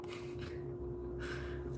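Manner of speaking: soft, high-pitched laugh; stifled laugh. Real, not acted. Host laughing to himself over an expected answer, quiet giggle. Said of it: "I don't know why I'm laughing".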